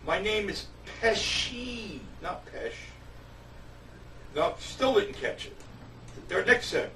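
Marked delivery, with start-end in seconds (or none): 0.1-2.0 slow, drawn-out "my name is Pesci" with Pesci as PEH-shee; insisting on his name. Retold or imitated. 2.2-2.7 whispered, curt "not Pesh"; under the breath. Retold or imitated.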